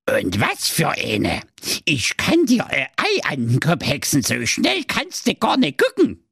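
put-on cackly, boastful old witch. A narrator's reading. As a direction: brag like a cheeky witch.

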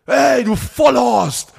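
angry cyclist's yell, hammed up; mock yell. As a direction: bellow it like the cyclist flying past. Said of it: "in so einer Sekunde".

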